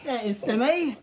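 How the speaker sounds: put-on high amateur British accent; attempted British accent. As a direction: introduce cats in a silly British voice. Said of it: "that accent you're doing".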